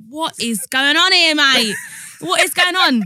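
loud, high, slow confused protest; baffled outrage. Retold or imitated. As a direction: demand to know what's going on. Retold baffled reaction to threat call.

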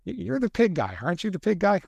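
a touch of imitated fan excitement; excited fan recognition. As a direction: fan spots the author in a bookstore, mildly excited. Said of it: "point at me at a bookstore and go".

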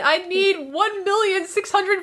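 high-pitched, feeble fake cry for help; half-hearted, hammed-up plea, no real volume; pathetic mock shout. Fan imitating Brain staging an accident, pathetic mock shout. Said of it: "shouts very pathetically".

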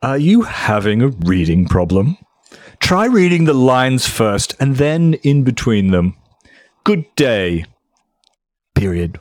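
a touch of Richard Dawkins condescension; pretentious ponderous English voice. Acted. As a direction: read it like a lofty English gentleman, only a hint of it. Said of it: "my generic, non-locale specific, pretentious, ponderous voice".

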